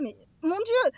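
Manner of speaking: a gasped, frightened exclamation, retold; retold shock. Mother hears she may lose baby.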